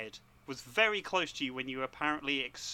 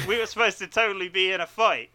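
louder, stretched surfer dude line; 4 semitones higher, 9 dB louder than usual. Retold or imitated. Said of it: "They don't talk like that at all".

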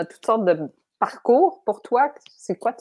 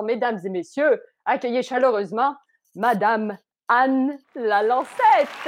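grand entrance announcement. Acted. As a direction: announce her like a host welcoming a star. Host introduces guest on podcast.

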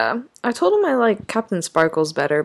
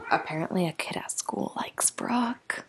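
whispering; 7 dB quieter than usual. Real, not acted. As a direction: gossip whisper. Sharing gossip secretly.

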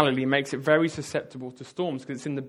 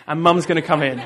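laughing; 7 dB louder, faster than usual. Real, not acted. Repeats line laughing.

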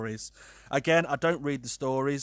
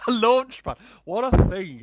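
high, loud laugh on the words; laughing disbelief; 9 semitones higher, 9 dB louder than usual. Real, not acted. Host mocking the launch pad name, laughing loud. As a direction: scoff at the silly name.

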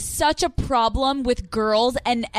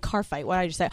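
much lower and softer; quiet self-mocking mumble; muttered aside; 6 semitones lower, 6 dB quieter, faster than usual. Real, not acted. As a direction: mutter at your own slip. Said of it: "self-mocking aside".

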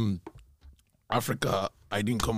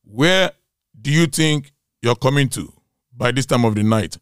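mother's stern demand; 9 dB louder than usual. Retold or imitated. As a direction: imitate a mother demanding answers in the dark. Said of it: "all I can hear was this".

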